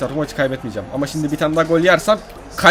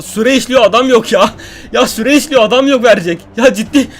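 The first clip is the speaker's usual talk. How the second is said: loud, higher, impatient cries; frustrated; 6 semitones higher, 6 dB louder than usual. Real, not acted. Gamer with time running out and no one to pass to, frustrated. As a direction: plead against the ticking clock. Clock running, no teammate free.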